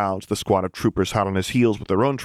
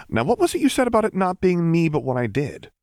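higher character voice asking; curious question; 10 semitones higher than usual. A narrator's reading. Luke pressing Rocco for details, higher and curious. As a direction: ask it lightly and higher.

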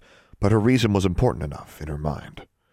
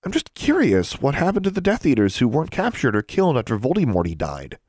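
put-on higher-pitched, composed girl's voice; curious schoolgirl; 7 semitones higher than usual. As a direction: ask the teacher as if merely curious. Pupil feigning casual curiosity to teacher.

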